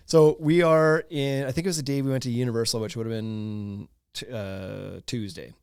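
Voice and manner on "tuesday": ordinary talk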